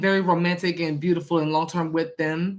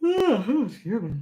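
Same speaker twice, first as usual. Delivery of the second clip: yawning; 3 semitones higher, slower than usual. Real, not acted. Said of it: "I don't know why I'm, like, yawning".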